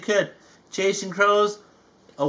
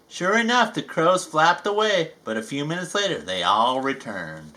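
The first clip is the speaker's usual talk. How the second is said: plain reading voice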